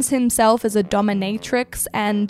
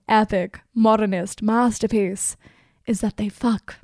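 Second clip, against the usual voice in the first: normal talking voice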